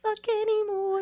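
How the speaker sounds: put-on high little old lady squeak; tiny old lady voice. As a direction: pipe it up like a tiny grandma. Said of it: "teeny tiny old lady voice".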